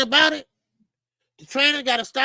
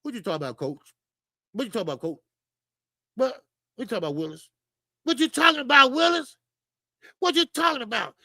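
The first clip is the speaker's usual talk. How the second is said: Gary Coleman impression; faster than usual. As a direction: do the Gary Coleman catchphrase, building up. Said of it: "Let me give you my Gary Coleman impression".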